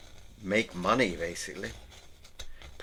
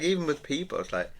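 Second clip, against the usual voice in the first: normal talking voice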